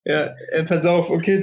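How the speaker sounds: a bit slurred from wine; slightly tipsy. Real, not acted. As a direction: talk like after two glasses. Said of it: "Ich lall gefühlt auch schon".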